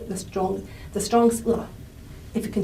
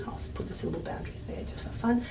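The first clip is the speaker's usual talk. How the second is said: ordinary talk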